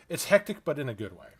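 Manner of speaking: ordinary talk, nothing marked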